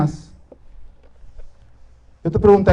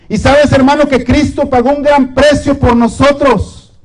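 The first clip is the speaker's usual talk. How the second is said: predicación enérgica; 5 semitones higher than usual. Acted. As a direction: drive it home to the congregation. Preaching climax to the congregation. (el predicador exhorta a los hermanos con fervor)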